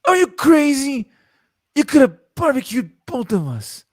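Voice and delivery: high, loud alarmed shout; Carlos Oliveira shouting. Retold or imitated. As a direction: yell at a reckless partner. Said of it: "what does he say?".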